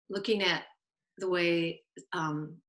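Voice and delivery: usual speaking voice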